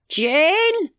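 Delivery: calling out. Acted. Husband to his deaf wife, calling out. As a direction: call her name to get attention.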